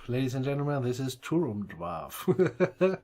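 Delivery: booming host introduction, laughing through it; grand announcement. Acted. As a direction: announce him to the room with a grin.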